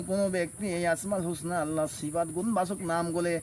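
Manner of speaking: ordinary talk, nothing marked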